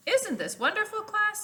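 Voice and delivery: high, bright teacher voice; cheery teacher Miss Frizzle. Acted. Teacher calling to her class on the island, high and excited. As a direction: sing it out to the class, delighted. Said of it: "said Frizzy".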